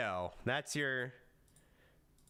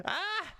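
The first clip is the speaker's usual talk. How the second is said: mock squeal; 4 dB louder than usual. High squeal.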